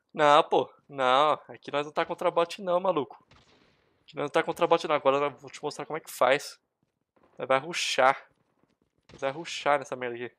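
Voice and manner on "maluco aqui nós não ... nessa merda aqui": ordinary talk, nothing marked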